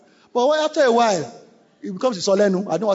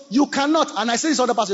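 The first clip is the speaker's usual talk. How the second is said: ordinary delivery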